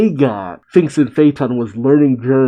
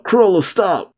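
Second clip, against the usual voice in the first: high-pitched call to halt; higher, mildly urgent acted cry; 7 semitones higher than usual. A narrator's reading. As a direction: call after someone running away, without full volume. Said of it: "Uvo again crawls out".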